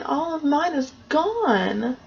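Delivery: small bear cub, upset over his empty bowl; crying baby bear. Acted. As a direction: play the baby bear, whimpering a little. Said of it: "he starts crying and saying".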